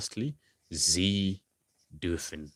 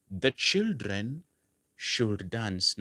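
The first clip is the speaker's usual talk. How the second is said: put-on The children drawn out slowly and raised, should dance dropping; slow model sentence; 2 semitones higher, much slower than usual. Shown as a demonstration. Teacher slowly modelling the example for the class. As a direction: lay out the English sentence slowly. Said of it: "You want to say".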